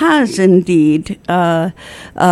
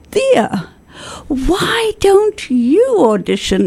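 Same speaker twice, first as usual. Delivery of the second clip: much higher, slower mock-coaxing invitation; imitating Willard; 10 semitones higher, slower than usual. Guest voicing Willard inviting her to audition, high and coaxing. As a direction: lightly put on a friend's coaxing voice, drawn out. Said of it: "and Willard said".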